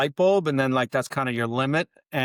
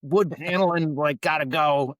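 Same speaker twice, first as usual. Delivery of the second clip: put-on Boston accent; 4 semitones higher than usual. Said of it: "say things in a heavy Boston accent".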